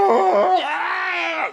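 very high, loud mock screech; hammed-up crying shriek; imitated screeching sob; screeching wail.

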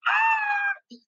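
a sudden, very high wailing shriek; mock the woman's sobbing scream; retold crying scream. Woman collapsing on the plane floor, high crying shriek. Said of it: "empieza a gritar, empieza a llorar".

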